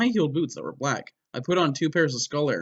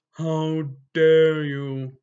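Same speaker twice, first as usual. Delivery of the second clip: very slow, drawn-out menacing demand; Snape voice; 2 semitones higher, 5 dB louder, much slower than usual. Acted. Snape demands an answer, slow and menacing. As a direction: drag out each word with cold menace. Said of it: "demanded Professor Snape".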